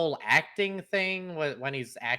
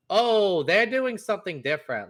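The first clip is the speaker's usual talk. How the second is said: louder, higher "oh" of dawning surprise; retold realisation; 6 semitones higher, 4 dB louder than usual. Viewer retells realising the film has changed, mild surprise. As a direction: act out catching on mid-film.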